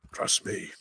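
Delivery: whispered aside; whispering. Shown as a demonstration. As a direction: whisper it like a secret. Joking repeat, second whispered.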